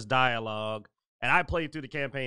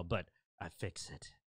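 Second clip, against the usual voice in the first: much softer, slower, a sly under-the-breath aside; mock humble aside; 8 semitones lower, 13 dB quieter, slower than usual. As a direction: slip it in quietly as if nobody should hear. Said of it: "I can't say that 'cause I'm going to be humble".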